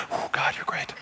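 hushed whisper to God; whispered awe among the crowd; whispered praise. Shown as a demonstration. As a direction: whisper it so no one hears. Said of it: "so I did one of these".

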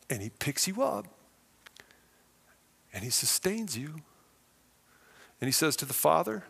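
ordinary talk

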